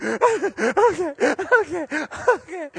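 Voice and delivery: high, teary okays; hammed-up teary okay; mock sniffling. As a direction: whimper agreement like a scared kid. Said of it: "I'm like".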